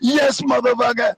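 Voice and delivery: high, triumphant reenacted cheer; retold gloating cheer. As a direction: relive gloating at rival fans. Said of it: "And I was like".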